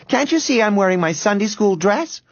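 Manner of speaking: put-on high little-girl protest; prim indignant girl. A narrator's reading. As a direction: protest primly about your good dress. Girl retorts in a quarrel.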